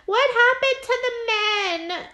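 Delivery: put-on high, crying child voice; mock whiny kid; kids asking. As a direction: squeal it like a scared little boy. Kids' voice in retold scene.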